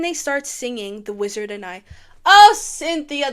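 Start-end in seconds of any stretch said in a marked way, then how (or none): 2.2-3.3 slow, loud swoon over the singer; drawn-out adoring cry; gushing. Real, not acted. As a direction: melt over her name.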